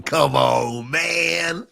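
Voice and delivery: hammed-up wail rising on "man"; mock pleading. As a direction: stretch it out and push it upward. Hammed-up plea, second take higher.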